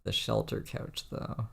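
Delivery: breathy whispered aside. Real, not acted. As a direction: whisper it as a joke. Host drops to aside.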